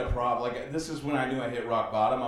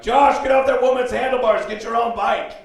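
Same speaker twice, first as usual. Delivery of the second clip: high, loud shout across a spin class; yelling instructor; 10 semitones higher, 13 dB louder than usual. Retold or imitated. Spin instructor orders a client off someone's bike, yelling. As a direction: yell like a fed-up spin instructor. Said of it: "instructor just yelled at me".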